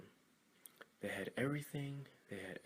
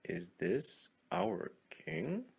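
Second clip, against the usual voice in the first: higher, slow disbelieving question; doubtful frog; 7 semitones higher, 4 dB louder, much slower than usual. Acted. A frog asks the others about the log king, slow and doubtful. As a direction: ask it slowly, unsure. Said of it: "One frog asked".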